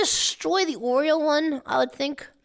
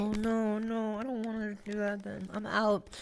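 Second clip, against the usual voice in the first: much softer, lower no, no; quiet refusal; 7 semitones lower, 11 dB quieter than usual. Real, not acted. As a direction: back away quietly, muttering. Refuses to eat the bugs.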